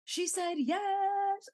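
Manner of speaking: high, slow drawn-out announcement; a retold triumphant shout, stretched out; mock yelled announcement. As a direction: call it out slowly to the whole roof. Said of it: "then he yelled".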